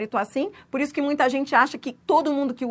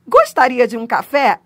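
courteous offer, louder and careful; polite offer; 8 dB louder than usual. Shown as a demonstration. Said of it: "ele indica polidez". (oferta educada e cortês)

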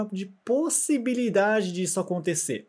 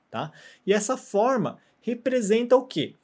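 ordinary talk, nothing marked

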